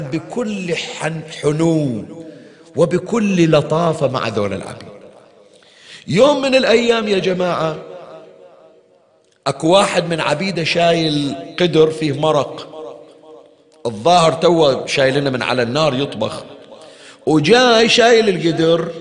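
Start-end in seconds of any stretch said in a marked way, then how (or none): none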